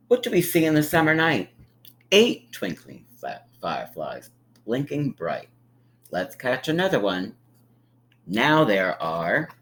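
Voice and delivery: sing-song picture-book read; gentle storybook narration; reading to children. A narrator's reading. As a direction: read it like storytime for little ones.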